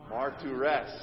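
"Martyres" said as mar-tu-res, the R's sounded; Greek martyres. Shown as a demonstration. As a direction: pronounce "Martyres" slowly, syllable by syllable. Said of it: "say that together".

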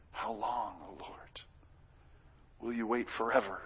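hushed, unvoiced cry to the Lord; whispered plea. Preacher quoting the psalmist's cry, whispered. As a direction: barely breathe the psalm's question. Psalm lament voiced in prayer.

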